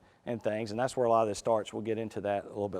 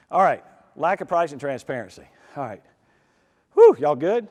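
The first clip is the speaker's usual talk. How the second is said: out of breath; 3 semitones higher, 9 dB louder than usual. Real, not acted. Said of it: "I'm out of breath".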